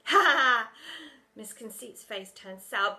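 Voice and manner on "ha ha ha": put-on loud high cackling kid; mocking laugh. A narrator's reading. Pupil laughing at the teacher, loud. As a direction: laugh at your own joke.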